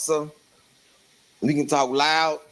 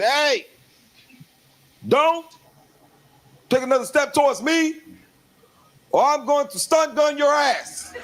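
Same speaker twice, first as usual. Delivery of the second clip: officer warning; 12 semitones higher than usual. Retold or imitated. Officer stepping back from the cousin, high stern warning. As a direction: back off and threaten the stun gun. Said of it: "and said".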